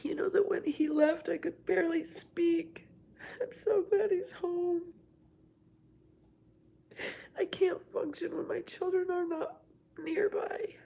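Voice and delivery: real tears, voice breaking high and whispered; crying. Real, not acted. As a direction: let the held-in grief spill out in a teary whisper. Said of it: "just let it out".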